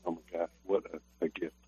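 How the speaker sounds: almost under the breath, moved; a hushed, tearful thank-you to God; hushed awe. Retold or imitated. Overwhelmed grandfather recalls answered prayer.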